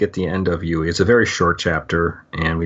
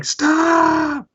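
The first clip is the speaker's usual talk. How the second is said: drawn-out, high-pitched mock yell; imitated yell. Yui cutting off Paul's reading, imitated high yell. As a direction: yell it very high and hold it, imitating a panicked outburst. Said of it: "Yui just starts yelling".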